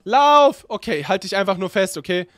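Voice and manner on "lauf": frantic panicked yelling; being chased. Acted. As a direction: shout in panic while fleeing. Fleeing teacher in game.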